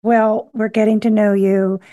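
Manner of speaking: ordinary talk, nothing marked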